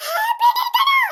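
high, loud incredulous laugh; shocked disbelief. Acted. Boy repeats teacher's words in shocked disbelief. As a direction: yelp it in amazed disbelief.

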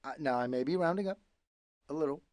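soft, rushed mumble; quick sheepish aside. Acted. Pigeon admits exaggeration.

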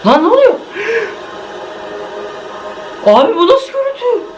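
high, alarmed disbelief; shocked. Real, not acted. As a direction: recoil from what you see. Shocked by gory images.

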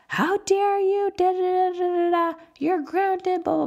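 a mocking take on an overreacting, scolding mom; mock heightened reaction. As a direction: imitate a parent losing it at her child, pitch shooting up. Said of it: "I say things out of a heightened reaction".

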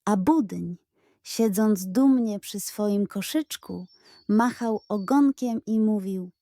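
usual speaking voice

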